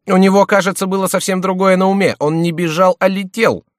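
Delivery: very high, loud, emphatic; agitated, high-pitched insistence; heated retort. A narrator's reading. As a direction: insist hotly, voice pitched right up. Heated reply about the enemy.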